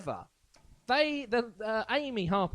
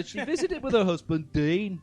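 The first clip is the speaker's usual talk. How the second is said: put-on higher, louder Birmingham accent; 4 semitones higher, 6 dB louder than usual. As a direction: read it like a Brummie. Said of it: "do it in a Birmingham accent".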